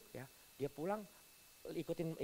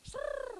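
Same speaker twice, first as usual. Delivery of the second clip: spinning top noise. Retold or imitated.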